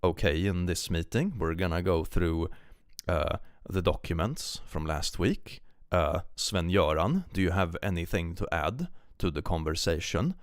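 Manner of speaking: put-on understated Swedish accent, plodding through a meeting; realistic Swedish accent. Swede chairing a meeting with Sven-Göran, thick Swedish accent. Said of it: "It's more like, you know".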